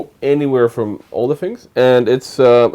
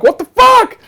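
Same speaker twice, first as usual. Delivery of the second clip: shocked; 5 dB louder, slower than usual. Retold or imitated. Shocked at Tinder photos.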